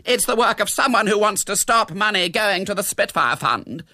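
high, loud alarm; high-pitched outrage; dramatic accusation. A narrator's reading. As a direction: announce the sabotage indignantly. Hostess cries sabotage.

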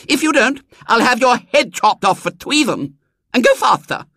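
put-on very high, lisping child's voice; bossy small tyrant giving orders; imperious little girl. Small girl ordering her wheelbarrow coachman, imperious and lisping.

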